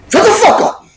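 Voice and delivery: high, sudden yell at the heckler. Acted. Host yelling at the mocking caller to shut up. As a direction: snap back hard and high.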